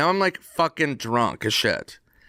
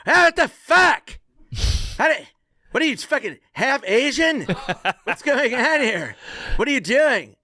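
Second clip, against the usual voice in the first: livid friend; 11 semitones higher than usual. Retold or imitated. Sore loser crushed at ping pong, mock fury. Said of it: "he's fucking livid".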